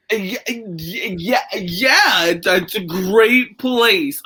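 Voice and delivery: slow, halting retold answer, searching for words; stalling for words. Answering a stranger's pickup line, awkwardly stalling. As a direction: stammer on "Yeah, yeah, yeah", then drag "great place". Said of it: "thinking of what to say".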